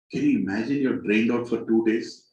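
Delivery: ordinary talk, nothing marked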